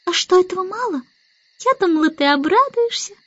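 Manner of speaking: put-on high, miffed little-girl voice; cross baby Annabel. A narrator's reading. Baby Annabel expected the starling to rejoice, high and miffed. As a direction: complain like an offended small child. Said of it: "сердито ответила Аннабелла".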